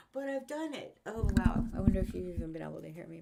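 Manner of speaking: usual speaking voice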